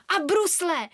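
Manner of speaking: eager, lifted shout; excited cry. A narrator's reading. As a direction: call it out with enthusiasm. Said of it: "vykřikla Nara nadšeně".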